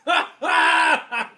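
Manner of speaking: big burst of laughter; loud laugh. Real, not acted. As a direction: howl with laughter. Player cracks up at name.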